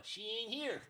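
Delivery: high, pinched dog with attitude; mock the man like a snotty dog; high squeezed puppet voice. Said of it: "can you do the voice".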